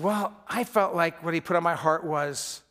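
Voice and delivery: ordinary talk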